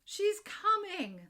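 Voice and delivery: high, loud, stretched-out announcement; acted excited cry; excited farmer. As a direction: call out the news of an arrival. Farmer announces the Queen in poem.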